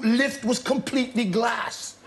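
ordinary talk

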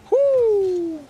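quick high cheer; high whoop. Real, not acted. Gamer cheering a play, high whoop.

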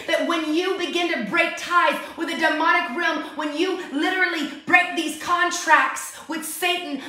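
fervent deliverance sermon building to a shout; full-force preaching. Acted. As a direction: preach it hard to the congregation.

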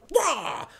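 high, squeaky "Wah!" of fright; mock startled yelp. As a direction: yelp like a cartoon hero caught off guard. Neo reacting to missile.

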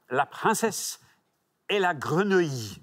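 savoured French title, broken by a pause; showy French pronunciation; French accent. Acted. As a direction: finish the list with French flair. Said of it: "a French accent, though, don't you".

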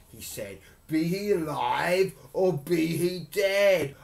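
booming, drawn-out chant that falls in pitch; scary giant. A narrator's reading. Giant sniffing out an Englishman, booming menacingly. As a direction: stretch each word like a hungry giant.

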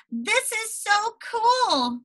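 high, slow delighted exclaim; child thrilled by the new computer, excited. Acted. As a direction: gush it out like a thrilled kid. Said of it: "exclaimed Lucy".

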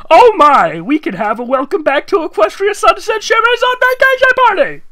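very high, loud excited squeal; high-pitched, high-energy Pinkie. Acted. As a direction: squeal it all out bursting with energy. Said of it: "high-pitched, high-energy voice that she talked in".

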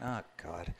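a dread-filled inner groan, low and soft. Retold or imitated. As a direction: sink into embarrassment.

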